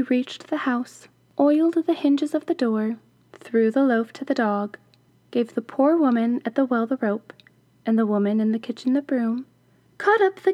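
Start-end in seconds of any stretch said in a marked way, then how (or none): none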